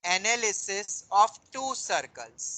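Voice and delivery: slow, drawn-out dictation of a heading; dictating. Real, not acted. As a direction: spell it out slowly for note-takers. (धीरे-धीरे लिखवाते हुए)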